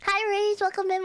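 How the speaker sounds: normal talking voice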